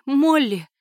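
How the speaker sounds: sudden high cry of the name; shocked cry. A narrator's reading. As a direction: cry out Молли in relief. Aunt sees niece alive.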